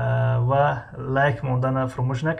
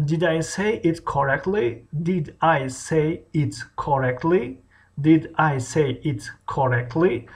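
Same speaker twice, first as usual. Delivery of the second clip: slow question with rising end; careful, separated lesson enunciation; teacher modelling; slower than usual. Shown as a demonstration. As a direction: repeat it slowly for learners to copy. Teacher repeats phrase for learners.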